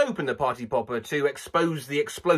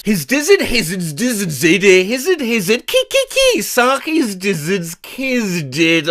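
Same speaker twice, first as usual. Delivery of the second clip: garbled noise words; 3 semitones higher than usual. Shown as a demonstration. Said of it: "determine exactly what noise it is".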